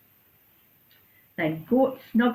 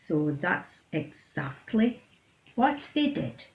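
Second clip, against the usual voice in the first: ordinary delivery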